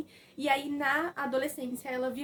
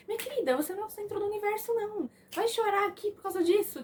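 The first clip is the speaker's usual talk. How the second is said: high, fast, snide put-down; mocking Joca; 8 semitones higher than usual. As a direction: sneer at her, pitched up and quick. Joca mocking her at funeral.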